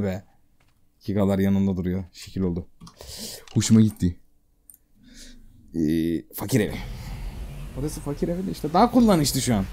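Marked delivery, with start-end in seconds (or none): none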